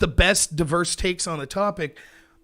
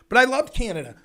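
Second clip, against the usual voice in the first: usual speaking voice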